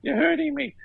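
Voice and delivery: high, put-on whine; hammed-up high complaint; mock pained protest. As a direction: wail it in pretend pain. Acting out the faked hurt.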